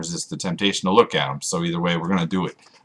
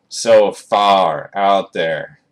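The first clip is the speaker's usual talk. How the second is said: drawn-out and wistful; slow dreamy echo; 4 dB louder, much slower than usual. Shown as a demonstration. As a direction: stretch each word far away. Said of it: "repeats phrase slowly".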